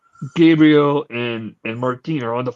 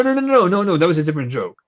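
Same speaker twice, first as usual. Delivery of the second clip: ordinary talk, nothing marked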